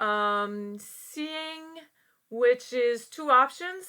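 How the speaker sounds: usual speaking voice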